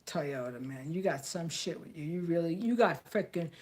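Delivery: ordinary talk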